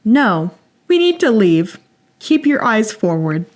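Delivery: flat, toneless warning; monotone voice. Retold or imitated. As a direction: say it dead flat and even. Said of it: "in a very monotone voice".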